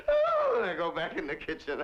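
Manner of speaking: ordinary talk, nothing marked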